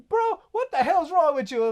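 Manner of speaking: acted indignant disbelief; mock exasperation. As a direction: imitate a baffled telling-off. Acted inmates challenging the Booty Warrior.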